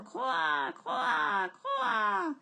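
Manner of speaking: croassements très aigus. A narrator's reading. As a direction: imite les corbeaux, très haut. Trois corbeaux imités. (croassements de corbeaux)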